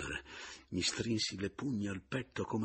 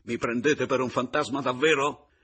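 loud, high, incredulous demand; heated, raised-voice question; shouted challenge; 7 semitones higher, 10 dB louder than usual. A narrator's reading. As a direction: challenge them at full voice. Narrator shouts at shocked pair.

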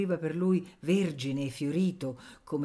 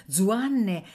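plain reading voice

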